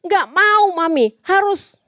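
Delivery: put-on high, insistent kid's whine; demanding child. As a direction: stamp your foot like a spoiled kid. Child refuses to wait. (anak merengek memaksa mami, suara tinggi)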